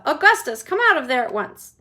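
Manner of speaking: loud commanding call; mother ordering loudly. Acted. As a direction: order him out. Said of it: "yelled Missus Gloop".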